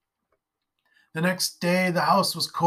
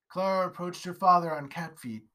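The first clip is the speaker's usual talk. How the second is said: ordinary delivery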